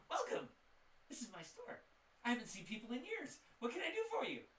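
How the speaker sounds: high, loud, overjoyed shopkeeper greeting; excited shopkeeper. Acted. Game master voices a lonely shopkeeper greeting the party, high and loud. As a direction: burst out welcoming the first customers in years.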